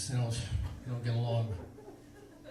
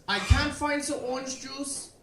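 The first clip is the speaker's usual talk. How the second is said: German interrogator; 10 semitones higher, 4 dB louder than usual. Retold or imitated. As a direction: interrogate like a cartoon Nazi about juice. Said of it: "He turns to me and he says".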